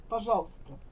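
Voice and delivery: normal talking voice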